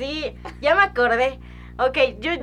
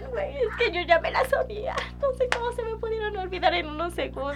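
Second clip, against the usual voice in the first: higher whiny mock sob; mock crying; 10 semitones higher, 3 dB quieter than usual. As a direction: whine like a crying kid. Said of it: "yo llorando así de". (imitando llanto de niña, más aguda)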